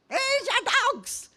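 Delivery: high, slow, angry yell of the neighbour. Retold or imitated. Neighbour at the door scolds the pastor, angry high shout. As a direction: throw it at them like an outraged neighbour. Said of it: "she just ripped into us".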